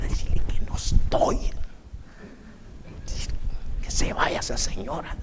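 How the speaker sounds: hushed whisper, hiding from the visitor; young man whispering to his sister to send the visitor away; retold whisper. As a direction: whisper it so she won't hear. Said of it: "Yo le decía a mi hermana".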